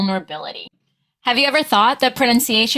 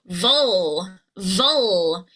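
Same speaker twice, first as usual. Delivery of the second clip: "vul" held long with a falling glide; long stressed syllable; 2 semitones lower than usual. Shown as a demonstration. Coach models the stressed syllable, drawn out. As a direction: stretch "vul" into a dark L. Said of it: "voice to go higher, louder, and longer".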